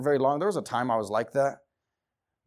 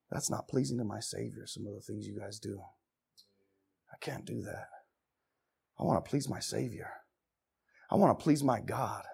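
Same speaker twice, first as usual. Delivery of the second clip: hushed reply; 6 semitones lower, 8 dB quieter than usual. Acted. Hushed quote answering friends.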